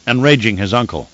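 plain reading voice

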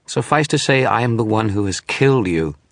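high, mocking contempt; scornful. Shadowy figure scorns the wizard's question, mocking. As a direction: sneer it at him. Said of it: "the shadow scorned".

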